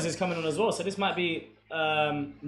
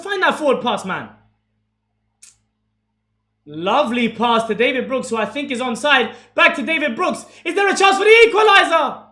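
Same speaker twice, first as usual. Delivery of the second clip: rising, much higher and louder play-by-play on the attack; live commentary; 7 semitones higher, 10 dB louder than usual. Acted. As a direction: call the chance like a TV commentator.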